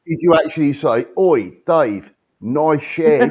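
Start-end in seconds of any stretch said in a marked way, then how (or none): 1.2-3.3 loud cockney "Oi, Dave, nice shed" greeting; a London geezer calling over to a mate; mock London accent. Teasing a friend's accent, loud London call. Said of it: "your London accent".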